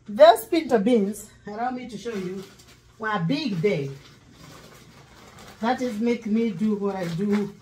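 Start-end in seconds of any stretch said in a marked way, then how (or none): none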